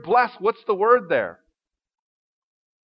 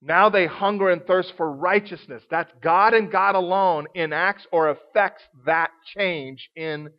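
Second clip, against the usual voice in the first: preaching; 5 semitones lower than usual. Acted. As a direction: bring it down to a hushed conviction.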